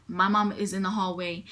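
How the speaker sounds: normal talking voice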